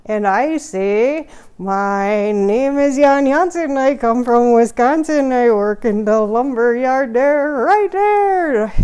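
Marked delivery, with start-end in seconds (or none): 1.6-8.7 put-on Wisconsin accent. Lumberjack introducing himself again in a Wisconsin accent. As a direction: belt it like a Wisconsin folk ditty.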